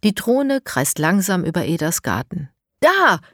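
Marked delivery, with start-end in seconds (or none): 2.8-3.2 high loud shout of discovery; excited call. Acted. Elias spots something on the drone screen, excited shout. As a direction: point and cry out. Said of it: "ruft Elias".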